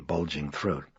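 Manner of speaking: usual speaking voice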